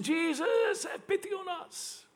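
a high, pleading call for mercy; high crying out. Retold or imitated. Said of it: "called out in a loud voice".

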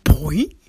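cartoon boing. Shown as a demonstration. Mimicking a movie's cartoon sound effect, springy boing. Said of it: "It was like".

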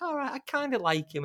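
high, sing-song aside in her voice; imitating the heroine, high and light; mock girlish musing. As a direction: voice the character's tentative crush, pitched well up. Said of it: "she's like".

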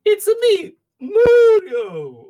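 loud, high cartoon plumber cry; high shouted game-mascot voice; Mario impression. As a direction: belt out the catchphrase up high. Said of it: "So when you say, "It's a me".

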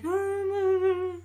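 put-on high, wailing kid's no; imitated child crying; mock crying. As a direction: whimper it like a hurt child. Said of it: "like, crying".

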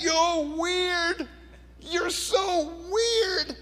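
father imitating his son yelling in the attic. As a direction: whine it like a sulky teenage son. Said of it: "my son's yelling back".

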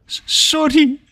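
very high, stuttered panic; squeaked, panicky stammer. A narrator's reading. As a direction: stutter out a frightened apology. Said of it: "reageert Charles paniekerig".

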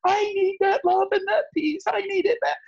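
very high mock-sobbing wail; imitated bawling. Retelling the friend breaking down, high mock bawling. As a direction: bawl it out in a squeaky high voice. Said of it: "the girl just starts bawling".

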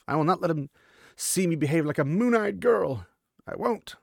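hammed-up determined resolve; stubborn vow. Girl's inner vow, acted.

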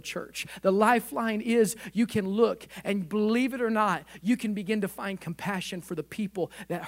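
loud, rising preacher's call; loud, fervent preaching of God's lifeline; full-force preaching. Acted. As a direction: drive the promise home to the room. Preacher voices God's message.

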